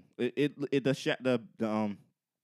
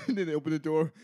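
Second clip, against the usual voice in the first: usual speaking voice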